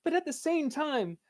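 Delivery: high, wry YouTuber narrator; high deadpan YouTuber voice; Scott the Woz voice. Retold or imitated. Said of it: "how Scott the Woz speaks".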